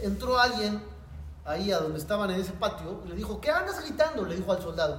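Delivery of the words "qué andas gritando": scolding officer. Retold or imitated.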